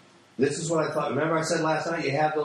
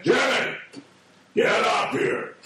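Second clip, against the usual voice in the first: higher, booming call down the street; booming dad shout; 6 semitones higher, slower than usual. Retold or imitated. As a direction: holler for your son like an angry father. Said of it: "my voice can really boom out".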